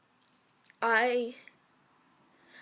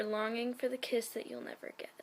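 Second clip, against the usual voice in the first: ordinary talk, nothing marked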